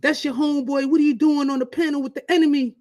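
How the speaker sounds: a hint of an upset wife; imitating upset wife. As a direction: mimic the wife scolding. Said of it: "She was like".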